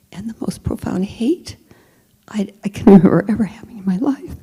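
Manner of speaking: hushed, whispered, quickening; whispered confession. Real, not acted. Narrator admitting her hate, whispered.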